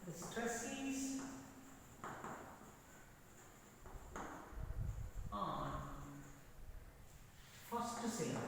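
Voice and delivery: halting, with long pauses between phrases; slow dictation. Real, not acted. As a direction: dictate piece by piece as you write. Writing the rule on the board.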